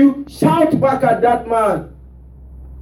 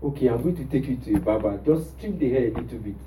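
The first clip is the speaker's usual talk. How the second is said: gentle coaxing; 9 semitones lower, 8 dB quieter than usual. Retold or imitated. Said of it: "I said".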